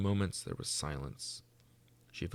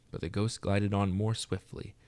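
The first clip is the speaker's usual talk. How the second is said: plain reading voice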